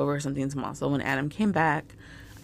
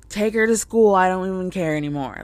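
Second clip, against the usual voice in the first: loud, higher snap at her husband; retold yell; 4 semitones higher, 6 dB louder than usual. Wife yelling at husband over broken printer, loud. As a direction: shout it like you've lost patience. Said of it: "I yelled at him".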